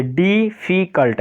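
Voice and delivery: Difficult in three separated syllables, डि, फि, cult; teacher counting out the syllables of difficult. Shown as a demonstration. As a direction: sound out each syllable of difficult. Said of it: "तीन syllable वाले".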